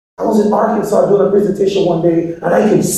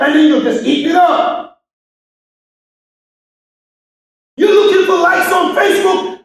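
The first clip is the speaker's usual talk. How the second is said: preaching at full force; 11 semitones higher than usual. Acted. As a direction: rouse the crowd, voice climbing to a shout. Motivational speech at full force.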